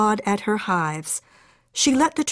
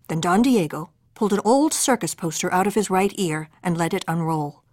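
usual speaking voice

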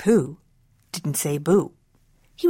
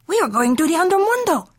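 high, quick Chihuahua voice; Chihuahua character; 10 semitones higher, faster than usual. Acted. Chihuahuas to Skipito, in eager character voice. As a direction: answer fast and high, like a little dog.

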